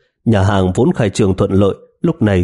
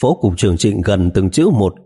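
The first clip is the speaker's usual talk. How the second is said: normal talking voice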